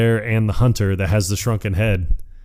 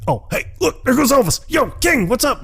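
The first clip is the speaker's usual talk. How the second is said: very high, airy mock voice; squeaky faked call to distract him. Beetlejuice fakes spotting [name] to the headhunter, squeaky high. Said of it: "And he's like".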